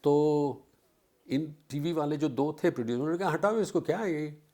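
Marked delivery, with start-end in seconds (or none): 3.3-4.4 higher, brusque take-it-away; dismissive producer. Retold or imitated. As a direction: wave him off impatiently. Producers rejecting him.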